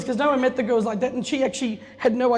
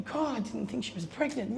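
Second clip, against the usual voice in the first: retold thought, hushed and much softer; the café owner's private doubt, voiced much softer; hushed inner thought; 9 dB quieter than usual. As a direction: mutter it to herself in disbelief. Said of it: "Mariana's going".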